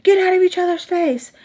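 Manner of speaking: high loud honk-like voice; horn as voice. Retold or imitated. The honking car scolding the two fighting women. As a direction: make the car horn talk. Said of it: "Voldemort saying".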